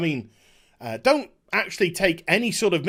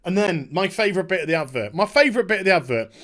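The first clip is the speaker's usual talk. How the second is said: usual speaking voice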